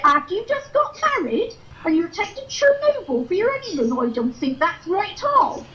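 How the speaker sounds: scandalised eccentric lady telling tales; dotty wig character. Acted. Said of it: "this one here, it's a lovely person".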